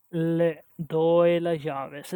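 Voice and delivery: Le doy las llaves said slowly word by word; slow careful practice. Shown as a demonstration. Learner slowly practising a confusing Spanish sentence. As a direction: spell it out slowly. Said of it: "It's a little confusing".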